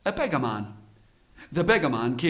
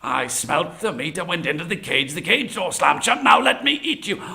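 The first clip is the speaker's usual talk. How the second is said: exasperated tiger; 4 dB louder than usual. Acted. Tiger repeating the story to the beggar, exasperated. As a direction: build impatience up to the last demand.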